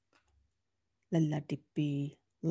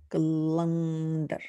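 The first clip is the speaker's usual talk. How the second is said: calendar stretched slowly, syllable by syllable; slow sounding out; much slower than usual. Shown as a demonstration. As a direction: say calendar slowly so a child can follow. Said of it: "That's how they sound".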